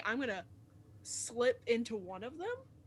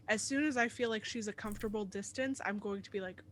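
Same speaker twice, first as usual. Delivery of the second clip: ordinary talk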